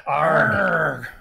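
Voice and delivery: loud, hammed-up pirate arg; pirate growl. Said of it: "do your, uh, pirate voice".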